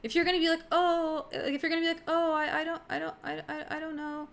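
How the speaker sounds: mock timid tourist dithering to a driver; timid hesitant voice. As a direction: play it small and unsure, backing down. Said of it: "you can't talk to them like that".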